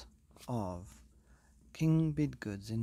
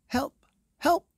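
high, loud panicked page; frantic cry for help; 11 dB louder than usual. Acted. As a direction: shout it in alarm like a frightened page. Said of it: "cried the page".

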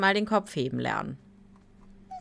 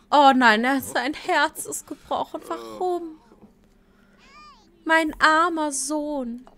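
higher, louder mock despair over her heartbroken son; hammed-up grieving mother; 9 semitones higher, 8 dB louder than usual. Streamer voicing Sim mother over her heartbroken son, hammed-up despair. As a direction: play the distraught mom, all theatre.

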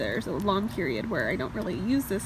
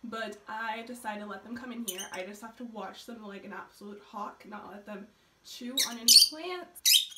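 ordinary talk

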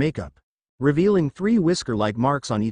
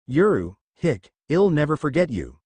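ordinary delivery

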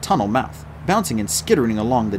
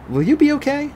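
much higher worried question; concerned; 4 dB louder than usual. Acted. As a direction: check on the injured. Artemis to Holly.

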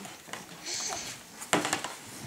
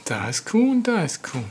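lilting picture book voice; reading to a toddler; 3 semitones higher than usual. Acted. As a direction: read to a little child.

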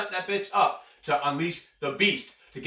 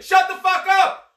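high, loud, slow shouted order; furious shout; 9 dB louder, slower than usual. Real, not acted. Host on a podcast yelling his new-year motto, furious shout. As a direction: yell it like you're done with the babbling. Fed up with everyone babbling.

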